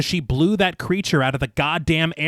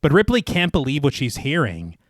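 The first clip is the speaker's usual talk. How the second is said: measured, lower narrator's read; story narration; 3 semitones lower, 3 dB quieter than usual. A narrator's reading. Narrator recaps the inquiry scene for listeners, measured storytelling. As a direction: read it like a polished audio storyteller. Reads scripted film recap.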